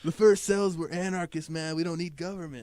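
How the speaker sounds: high, loud mock stoner preaching; stoner rant. Riffing with his cohost as a stoner about cells, loud mock preaching.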